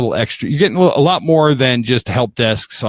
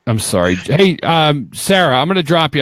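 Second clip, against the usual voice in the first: ordinary talk, nothing marked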